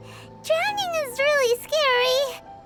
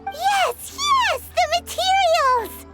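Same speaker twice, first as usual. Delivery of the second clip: higher, excited confirmation; all excited; 3 semitones higher, slower than usual. Acted. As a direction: cheer that the message got through. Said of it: "Paimon got all excited".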